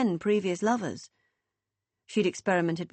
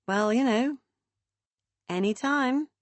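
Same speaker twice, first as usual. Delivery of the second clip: dryly; 5 semitones higher than usual. A narrator's reading. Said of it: "Meg replied dryly".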